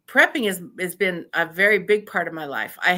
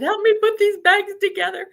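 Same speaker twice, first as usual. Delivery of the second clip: eager plea; 13 semitones higher, 6 dB louder than usual. Retold or imitated. Asking neighbor for help.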